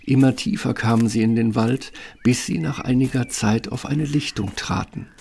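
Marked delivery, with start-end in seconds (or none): none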